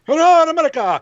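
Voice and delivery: high, loud showy greeting to the flag; mock patriot. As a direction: ham up a patriotic hello.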